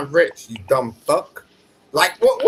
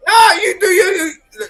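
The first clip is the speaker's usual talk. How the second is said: high, loud heated yell; angry shout; 10 semitones higher, 4 dB louder than usual. Real, not acted. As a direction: yell it back at him. Heated argument, shouting back.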